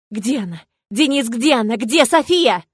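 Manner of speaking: panicked yell rising to a scream; breaking into a scream. A narrator's reading. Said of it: "Срываясь на крик, истошно воплю я".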